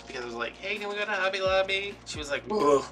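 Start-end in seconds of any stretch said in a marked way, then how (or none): 0.6-2.0 high-pitched hopeful asking; retold eager plea. Retold eager request to mom. 2.5-2.8 loud mock groan of reluctance; imitated groan. Mom's retold reaction to request.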